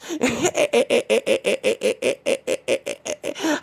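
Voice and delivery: long high maniac cackle; crazy laugh. Retold or imitated. Woman chasing David up the block, crazy high cackle. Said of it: "started doing that crazy laugh".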